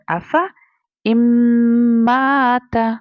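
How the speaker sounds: अफ़ा इम्माता drawn out, the middle alif silent; long held इम्माता; slow recitation. Shown as a demonstration. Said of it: "तो क्या बन जाएगा?".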